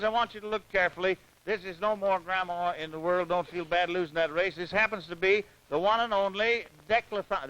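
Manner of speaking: ordinary delivery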